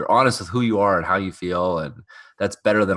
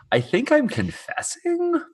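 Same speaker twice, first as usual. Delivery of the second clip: mock realization; slower than usual. Real, not acted. Joking about the scene.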